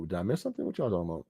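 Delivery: ordinary talk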